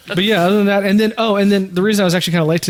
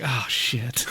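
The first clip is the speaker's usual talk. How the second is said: low, softer mock dismay; retold embarrassment; 5 semitones lower, 9 dB quieter than usual. Friend surprised with a cake, quietly embarrassed. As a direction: imitate a quiet, embarrassed reaction.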